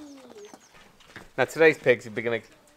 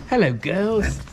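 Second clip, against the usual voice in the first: sing-song greeting; 7 semitones higher, 5 dB louder, much slower than usual. Retold or imitated. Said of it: "I meant".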